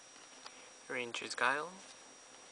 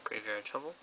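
normal talking voice

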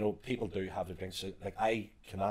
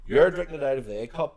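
loud, high call to the next room; retold shout, pitched up and loud; 8 semitones higher, 11 dB louder than usual. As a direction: shout it through from the kitchen. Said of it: "shouting this out to me in the living room".

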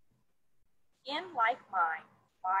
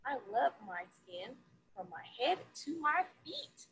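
lively picture-book read-aloud; reading to children. A narrator's reading. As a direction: read it to a classroom of kids, bright and sing-song.

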